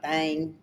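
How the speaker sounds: 'Thang' for 'thing', vowel widened toward AY; Southern pronunciation. Shown as a demonstration. Showing how we Southerners talk, 'Thang' for 'thing'. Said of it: "we're like".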